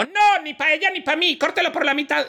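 high falsetto spiteful woman. Acted. The other woman tells Solomon to cut the child, high. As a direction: snap it out high and cold. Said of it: "Y la otra señora dijo".

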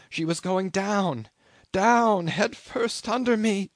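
high, urgent retelling; mounting alarm. A narrator's reading. Survivor tells of the ship sinking under them, in rising alarm. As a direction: climb in pitch and hit the second "down" hard.

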